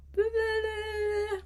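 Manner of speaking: high, slow, silly tongue-out blah; wombat blah. Pretend. Showing Gretel how Wombat pokes out his tongue, silly high blah. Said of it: "sticking out your tongue like Wombat".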